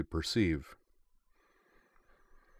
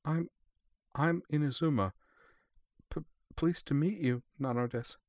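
high, soft and timid with a stammer; shy scared girl, breathy; small, breathy voice; 8 semitones higher, 4 dB quieter than usual. A narrator's reading. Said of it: "said in a small, breathy voice".

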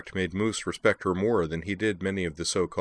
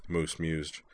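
ordinary delivery

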